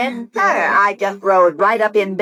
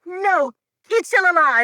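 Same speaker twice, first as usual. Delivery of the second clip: panicked blurt; 8 semitones higher than usual. Acted. Wife blurts out the truth.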